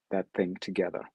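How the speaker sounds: usual speaking voice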